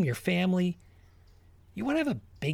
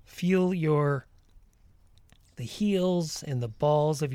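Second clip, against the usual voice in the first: guided meditation. Acted. Leads a walking meditation.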